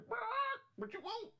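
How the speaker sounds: squeaky very high barking voice; Blue Parrot headset. Retold or imitated. Trucker voicing his headset as a rude parrot, very high and loud. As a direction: yap it out like a rude little gadget. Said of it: "Blue Parrot say".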